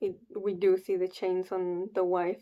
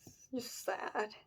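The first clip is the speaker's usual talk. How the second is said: slow, fading, much softer words of sorrow; saddened; 4 dB quieter, slower than usual. Real, not acted. Reader overcome by the sad panel, slow and hushed. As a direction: trail off, genuinely moved.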